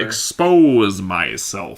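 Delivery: very slow, drawn out; Thor's phrase. Retold or imitated. Singer mimics Thor's odd phrase, very slow. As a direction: stretch out expose myself like Thor. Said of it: "let's go ex".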